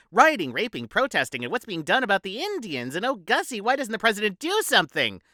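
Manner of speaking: a hint of the preachy mother tutting; Gussie's mother lecturing the ladies, mock high matron; mock society mother.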